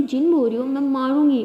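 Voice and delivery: fake-angry warning, teasing back; mock threat. Wife playfully threatens her teasing partner, mock angry.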